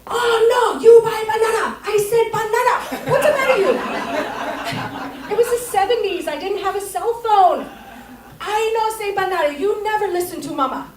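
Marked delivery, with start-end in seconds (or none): 0.1-5.3 high, loud scolding mother voice; hammed-up angry mom; mock scolding mom. Mom scolds wrong pudding. 8.4-10.9 higher mock mom voice; a milder mock scolding mom; imitating scolding mom.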